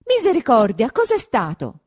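high, loud startled cry; a servant jolted by a scream; alarmed shout. A narrator's reading. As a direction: shriek of alarm, then a quick question. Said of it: "gridò Perpetua".

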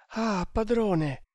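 high, drawn-out appeal; dismayed servant's plea; pleading lament. Acted. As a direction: sigh it out slowly to the master, pitched high. Servant laments master's dangerous departure.

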